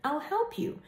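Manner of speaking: lightly voiced sly snake; a hint of a scheming snake; sly snake offer. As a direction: offer help with a hidden bite. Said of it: "But Snake said".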